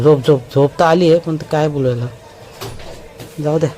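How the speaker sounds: ordinary delivery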